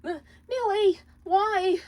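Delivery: high, broken sobbing plea. Acted. Moxie pleading with his wife who betrayed him, sobbing. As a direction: beg through tears. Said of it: "Moxie sobbed".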